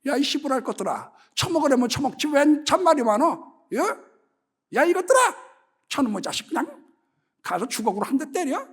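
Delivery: mock cursing grandma yelling at diners; foul-mouthed granny. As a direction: bark it like a cursing old woman at customers. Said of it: "욕쟁이 할머니가 되는 거죠".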